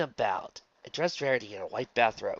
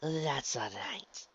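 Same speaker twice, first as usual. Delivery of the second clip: soft, slow, lulling; smiling reassurance; 4 semitones higher, 6 dB quieter, much slower than usual. A narrator's reading. Ella reassuring Luna, gently smiling. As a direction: soothe her slowly with a smile. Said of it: "Ella said with a smile".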